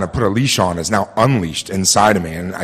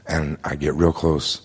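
usual speaking voice

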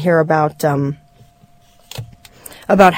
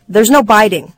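louder, higher parental shout; 3 semitones higher, 5 dB louder than usual. Acted. Father breaking up the boys' fight, shouted. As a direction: lay down the rule loudly. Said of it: "I shouted".

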